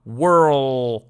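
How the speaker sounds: whirl with a long held r; drawn-out r. Shown as a demonstration. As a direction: stretch the r in whirl. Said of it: "اما آر رو بگو ر". (whirl با آر کشیده)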